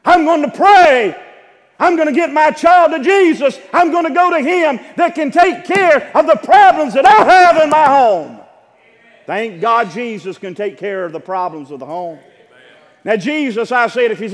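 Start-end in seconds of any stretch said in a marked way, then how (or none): 0.0-8.4 determined cry. Acted. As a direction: cry it out like a parent who won't give up. Preacher voices a determined parent.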